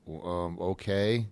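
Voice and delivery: soft, hesitant reply; unimpressed kids. Retold or imitated. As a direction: mumble back low and unsure, as kids. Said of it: "and the kids are like".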